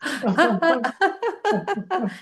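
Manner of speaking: high, rolling peal of laughter; exercise laugh. Shown as a demonstration. Teacher leads laughter exercise, high rolling laugh. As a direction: keep laughing freely.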